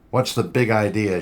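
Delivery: sharp, loud demand. Acted. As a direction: confront him hard. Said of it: "she demanded".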